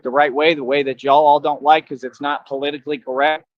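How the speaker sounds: usual speaking voice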